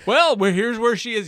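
very high, very loud mock reveal; mock show presenter. Co-host acts out the follow-up episode, loud hammed-up presenter. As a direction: belt it out as a spoof TV reveal. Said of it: "the follow-up episodes".

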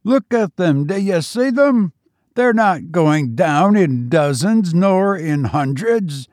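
high excited shouting in a Cockney soldier's manner; can't believe his eyes, voice up high; thrilled Tommy shouting at the falling enemy; amazed soldier. A narrator's reading.

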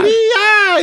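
very high, loud cartoon "Ria!"; mock Mario-style spin yelp, high and loud; squeaky spin noise. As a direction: squeal "Ria!" like a spinning jump. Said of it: "that noise".

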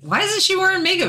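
high, fast imitation of mom. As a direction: quote mom in a high, quick voice. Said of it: "my mom was like".

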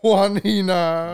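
mock Spanish accent. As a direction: say Juan Hina like a Spanish name. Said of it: "mock Spanish name echoed".

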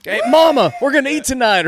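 high, loud mock jubilation; overjoyed. Imagined actor tells Mama they'll eat tonight, overjoyed. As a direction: shout the good news up high.

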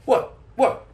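high-pitched mock Italian-film outburst; Italian movie character. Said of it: "you guys have seen the Italian movie. It's like".

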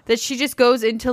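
ordinary talk, nothing marked

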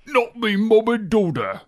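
put-on accent with a Scottish tinge; slightly Scottish accent. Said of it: "That's a little Scottish".

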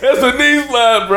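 high, loud laughing mock line; laughing mock of the classmate. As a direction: parody the classmate's goofy punchline. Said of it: "repeating the classmate".

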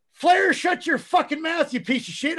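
mock-furious yell at a lazy rookie; retold angry yell. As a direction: bark it at him like you mean it. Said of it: "I'd look at him and go".

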